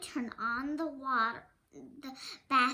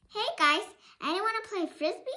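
put-on a bright call to the other kids, higher and louder; 7 semitones higher, 5 dB louder, much faster than usual. Maddie calling to the other children, a loud friendly shout. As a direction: call out to the group across the yard.

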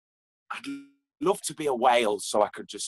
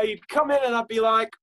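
ordinary talk, nothing marked

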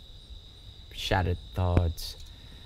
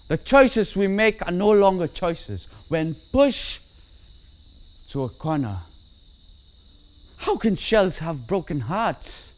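high, raised voice, protesting in anguish; impassioned outburst; 10 semitones higher, 5 dB louder than usual. Acted. A lone speaker protesting choices forced by a corner, impassioned outburst. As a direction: burst out in high, dramatic protest.

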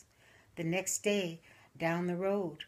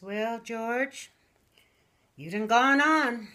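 put-on higher, louder, slow drawn-out old widow; old widow to urn; 5 semitones higher, 9 dB louder, much slower than usual. Widow on her porch talking to George's urn, slow and drawn out. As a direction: croon it to her late husband's urn. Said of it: "and she says".

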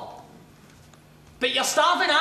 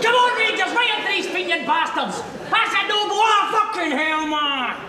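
raised-pitch fan yell; rowdy supporter's roar; rousing football shout; 3 semitones higher than usual. Acted. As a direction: cheer your team on, aggressively.

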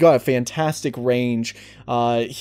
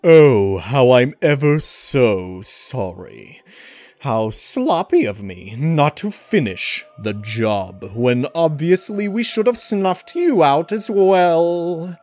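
slow, theatrical villain building to a much higher, louder bellow; Sir Raleigh the Frog; 4 semitones higher, 6 dB louder, slower than usual. Retold or imitated. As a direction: start with mock politeness, then swell into a huge, stretched-out threat. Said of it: "the voice of the character Sir Raleigh the Frog".

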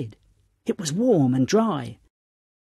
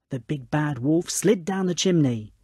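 ordinary delivery, nothing marked

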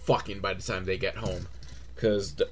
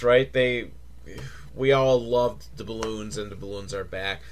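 ordinary talk, nothing marked

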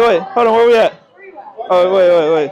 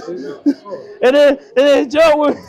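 laughing; 12 semitones higher, much slower than usual. Real, not acted. Laughing while explaining the jump.

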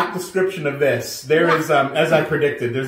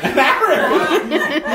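surprised laugh; 13 semitones higher, 4 dB louder, slower than usual. Real, not acted. Mom ate Doritos an hour ago.

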